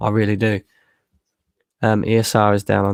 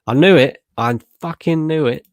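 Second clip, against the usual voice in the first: loud and higher, vindicated; triumphant outburst; 5 semitones higher, 5 dB louder than usual. Real, not acted. Streamer to chat, realising he was trolled, loud triumphant shout. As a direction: shout it, you called it all along.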